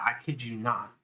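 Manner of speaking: ordinary talk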